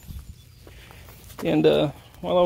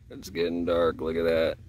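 deep spooky voice; 13 semitones lower, 5 dB quieter than usual. Pretend. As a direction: drop the voice way down, spooky.

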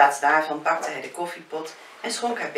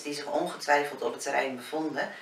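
ordinary delivery, nothing marked

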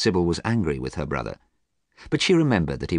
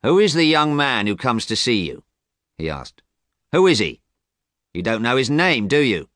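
raised, insistent questioning; suspicious brother; 5 semitones higher, 5 dB louder than usual. Acted. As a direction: demand answers, higher and louder. Voices James questioning his sister.

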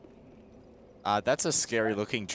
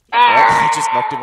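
shrieking, high and loud; excited shout; 14 dB louder than usual. Real, not acted. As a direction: shout in gleeful surprise. Enemy falls off ledge.

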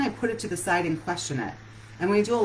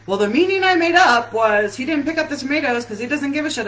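higher, louder petulant grumble; little angry guy; 7 semitones higher, 8 dB louder than usual. Pretend. Inner angry guy complains husband doesn't care, higher and louder. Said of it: "the little angry guy in there is like".

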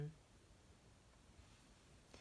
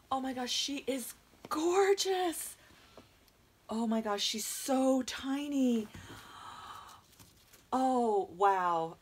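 loud, high, drawn-out gush; awestruck gushing; 6 semitones higher, 8 dB louder, slower than usual. Real, not acted. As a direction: stretch the praise out in real delight. First sight of the new doll.

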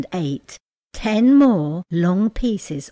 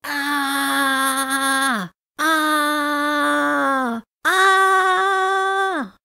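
rising, higher hammed-up screams; pained screams; 8 semitones higher, much slower than usual. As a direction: scream as the hot sauce burns, each one higher. Hot pepper sauce burning mouth.

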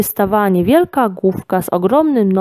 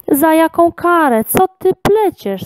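much higher, exclaiming in disbelief; incredulous lion; 10 semitones higher, 4 dB louder than usual. A narrator's reading. Lion answers the girl's talk of punishment, high-pitched and incredulous. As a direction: throw the question back in disbelief. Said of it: "zlitował się lew".

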